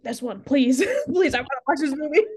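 eager pleading. Retold or imitated. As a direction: beg eagerly, half laughing. Retelling eager begging for movie.

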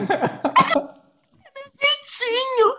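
high, slow, coy sing-song; coy excuse. Retold or imitated. The exposed gossip excusing himself, high and coy. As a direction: drag it out like a caught gossip shrugging.

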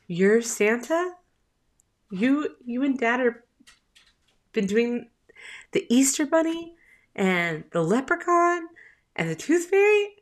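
high, incredulous imitation of a child; tearful son imitated. Son realizing mom is Santa, high incredulous child voice. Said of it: "his eyes just welled up with tears".

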